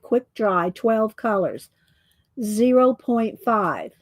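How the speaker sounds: ordinary talk, nothing marked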